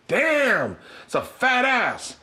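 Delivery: high, drawn-out imitated catcall. [name] to women walking by, catcalling. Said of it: "[name] is catcalling women".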